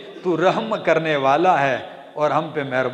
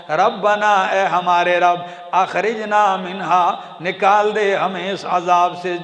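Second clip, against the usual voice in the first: chanted Quran verse, then ardent translation; preaching recitation; 2 semitones higher, 4 dB louder than usual. Acted. Preacher reciting to congregation, fervent chant.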